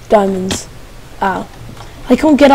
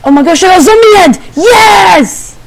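thrilled yelling; excited shout; 7 semitones higher than usual. Real, not acted. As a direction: shout it out in triumph. Zombie head found.

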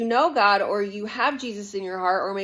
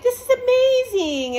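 much higher, louder squeal of delight; giddy new love; 5 dB louder than usual. Retold or imitated. A new partner gushing at the start of a relationship, with a high squeal. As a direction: gush like you're freshly smitten. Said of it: "you're like".

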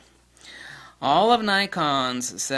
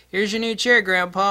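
higher, louder teasing offer; mock cheery offer; 7 semitones higher, 6 dB louder than usual. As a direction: hand grandpa his chair brightly. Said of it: "He's like".

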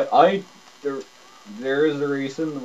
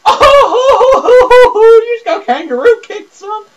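much higher, loud laughing yell; laughing shout; 16 dB louder, slower than usual. Real, not acted. Gamer cracking up as the opponent gets kicked, laughing shout. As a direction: cackle and shout at once. Gamer reacts to a kangaroo kick.

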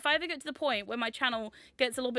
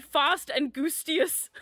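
quoted friend's pun; 3 semitones higher, 4 dB louder, much slower than usual. Retold or imitated. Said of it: "my friend Kamori just said".

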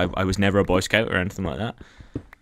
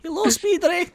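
very high searching call; calling for a pet. Real, not acted. Player calling into the cave for an answer, high-pitched shout. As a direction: sing out as if seeking someone lost. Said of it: "looking for a pet spider".

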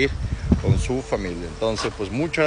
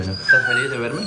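high pet talk. Real, not acted. Vet asks his dog patient in high baby talk if he's happy.

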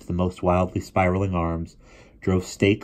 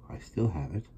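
said quietly and softly; 8 dB quieter than usual. A narrator's reading. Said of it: "said Will quietly".

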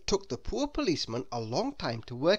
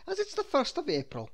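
higher officer's statement; smiling policeman; 11 semitones higher than usual. A narrator's reading. The policeman explains the muddle, smiling. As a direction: state it knowingly, pitched up. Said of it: "he said, smiling".

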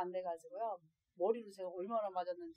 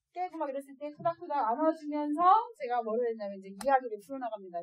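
normal talking voice

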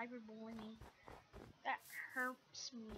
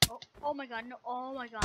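ordinary talk, nothing marked

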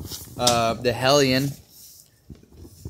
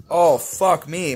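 slow, drawn-out pained curse; 3 semitones higher, 4 dB louder, slower than usual. Real, not acted. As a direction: groan it out as the heat hits. Nose burning from chili.

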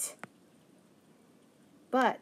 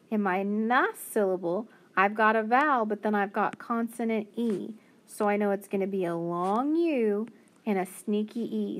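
ordinary delivery

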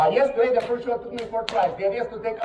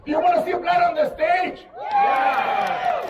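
hype shout; 10 semitones higher, slower than usual. Acted. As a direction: whip the crowd up. Hyping crowd.

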